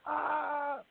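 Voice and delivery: high, held "ah" without real volume; restrained imitation scream; mock scream. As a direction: imitate a pained yell, pitched high. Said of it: "screaming and yelling".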